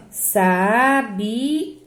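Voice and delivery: "sabiá" as SA... BI... Á, slow; separated syllables. Shown as a demonstration. Teacher dividing the word for pupils, syllable by syllable. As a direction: part "sabiá" into three long beats, final Á stressed. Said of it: "eu separo assim".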